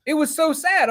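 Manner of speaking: high-pitched retold whine; playfully aggrieved, high voice; mock upset complaint. Retold friend complaining about sad book.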